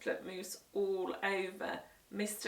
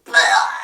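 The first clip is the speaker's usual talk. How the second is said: hugely louder low spitting blah; loud splutter; 13 semitones lower, 20 dB louder than usual. Acted. Guest tasting rotten crisps, loud spluttering blah. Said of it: "she spluttered".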